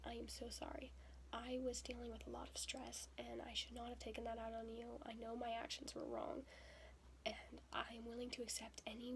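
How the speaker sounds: retold soft apology; quiet apology.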